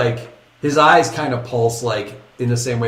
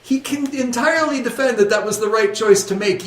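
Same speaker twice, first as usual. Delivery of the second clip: usual speaking voice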